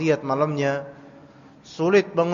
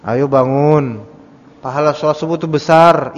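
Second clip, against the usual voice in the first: louder parent's wake-up call; 3 semitones lower, 7 dB louder than usual. Retold or imitated. Parent rousing a sleepy child, calling out louder. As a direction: call the child up for dawn prayer. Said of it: "Coba kalau misalnya kita bangunin anak".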